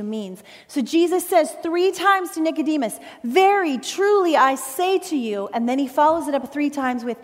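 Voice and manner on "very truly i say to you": slow, forceful declaration; solemn emphasis on every word; emphatic, serious. Acted. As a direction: pronounce it deliberately, with weight. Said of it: "emphatic statement that's very serious in nature".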